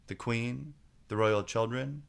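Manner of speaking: ordinary delivery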